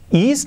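"is" said as "ees" with a tight i; wrong Spanish-style vowel. Shown as a demonstration. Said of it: "nada de is".

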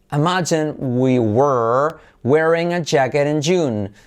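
slow, separated teacher delivery; measured pronunciation drill; slow model sentence. Shown as a demonstration. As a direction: model it phrase by phrase for learners. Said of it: "la frase de referencia".

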